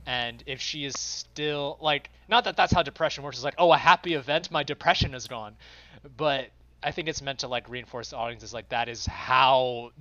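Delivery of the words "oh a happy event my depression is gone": mock relief. Mocks naive view of depression.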